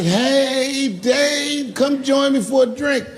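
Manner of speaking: a hint of [name] calling him over; lightly voiced high invitation; [name]'s voice.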